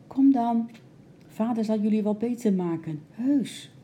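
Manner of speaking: put-on high little girl's coaxing; high-pitched, reassuring child voice; soft little voice. A narrator's reading. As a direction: coax them in a small high voice. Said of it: "riep ze met een zacht stemmetje".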